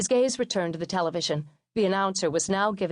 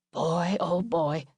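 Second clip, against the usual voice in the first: slow, tired father's sigh of words; a lightly voiced dad shaking his head; slow resigned dad voice; weary father; much slower than usual. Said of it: "he said".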